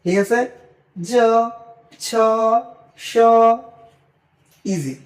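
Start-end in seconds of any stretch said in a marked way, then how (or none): 1.0-3.1 drawn-out জ, ছ, স, each alone; Bangla letter sounds. Shown as a demonstration. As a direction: say জ, ছ, স as plain Bangla letters, held long. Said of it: "just Bangla".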